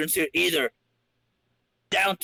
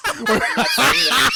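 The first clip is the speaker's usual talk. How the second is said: louder, higher refusal "I'm not saying that"; mock high protest; 6 semitones higher, 7 dB louder than usual. Real, not acted. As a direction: protest it with a raised, pitched-up voice.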